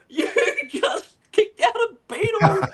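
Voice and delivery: high, laughing squeal of disbelief; mock-shocked falsetto through laughter; shocked celebrities. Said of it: "they're going".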